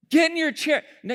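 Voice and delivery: ordinary talk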